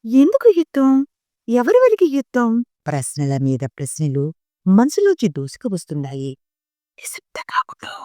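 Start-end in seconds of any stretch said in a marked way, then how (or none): 0.1-2.5 lightly voiced little girl, higher; a hint of an insistent small child; girl asking again, a touch higher; child's questions. A narrator's reading. 7.0-7.9 urgent hushed whisper; frightened mother whispering for silence. A narrator's reading.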